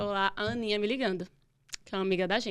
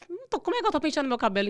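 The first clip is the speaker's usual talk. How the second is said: fast, higher, louder retort; indignant disbelief; 7 semitones higher, 5 dB louder, faster than usual. Retold or imitated. Patient answering the doctor about her hair, indignant retort. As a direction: re-enact a baffled protest to the doctor.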